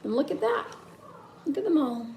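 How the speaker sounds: usual speaking voice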